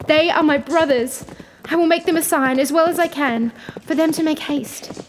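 high, excited cry; joyful. A narrator's reading. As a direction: announce rescue with urgent joy. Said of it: "replied the poor wife joyfully".

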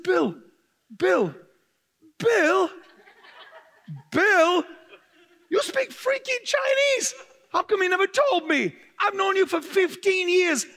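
high, loud, outraged pleading at a friend; exasperated yelling. Retold or imitated. Friend who hid his fluent Chinese, shouted at outside the cafe, high and loud. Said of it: "I said".

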